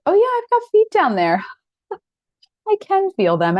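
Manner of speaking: a touch of giggly mock surprise. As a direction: pop up high like finding something new. Mock discovery of own feet.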